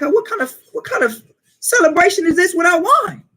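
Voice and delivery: high, playful party outcry; festive exclaim. Retold or imitated. Yahweh Shai at the wedding asking where the wine is, high and playful. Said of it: "It's like".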